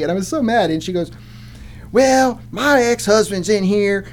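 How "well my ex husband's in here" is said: slow, drawled put-on country woman; lightly voiced small-town complainant; backwoods lady. Lady explaining her ex-husband to the police, lightly hammed-up.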